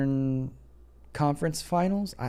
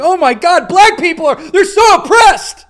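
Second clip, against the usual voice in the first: very high, loud, laughing mock lament; mocking rich rappers' complaint, high and loud; mock whining; 13 dB louder than usual. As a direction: squeal it like a fake victim. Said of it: "It's like".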